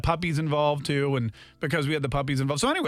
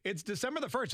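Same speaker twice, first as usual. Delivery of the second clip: radio announcer; 6 semitones higher, 9 dB quieter than usual. Acted. Radio station ID read.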